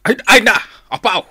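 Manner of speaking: loud, high yelp of surprise; startled exclamation. Acted. Husband exclaiming over his meal, startled.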